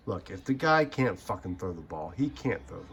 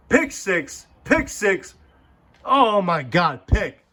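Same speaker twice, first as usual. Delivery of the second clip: much higher and louder, a fan losing it; mock excited yell; 10 semitones higher, 11 dB louder, slower than usual. Mocking the quarterback's interceptions, a hammed-up excited yell. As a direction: shout it like a frantic fan.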